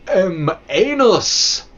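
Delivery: high, loud punchline yell of anus; mock shout. Streamer shouting the pun at his chat, loud and high. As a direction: bellow it as a crude pun.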